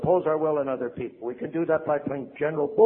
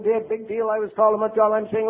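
ordinary talk

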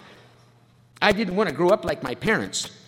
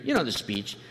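usual speaking voice

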